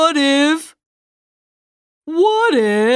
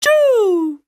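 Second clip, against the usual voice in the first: sharp sneeze burst; 7 semitones higher than usual. Acted. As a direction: let the sneeze pop. Sneeze finally comes.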